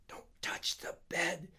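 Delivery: slow, high, hoarse warning; pained straining whisper. A narrator's reading. Harry warns his friend off the bed, pained straining whisper. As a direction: whisper as if shot in the stomach. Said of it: "still speaking like he'd been shot in the stomach".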